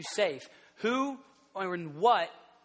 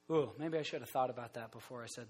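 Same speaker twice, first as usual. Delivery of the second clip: lightly voiced sheepish Peter, quick and hushed; Peter second-guessing himself; 8 semitones lower, 9 dB quieter, faster than usual. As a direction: mutter the regret under your breath, a bit faster. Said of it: "and then he'll go".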